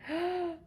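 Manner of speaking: a sharp, high theatrical gasp; mock gasp. Reader reacting to friend's warning, hammed-up gasp. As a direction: gasp as if at juicy drama. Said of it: "And I'm like".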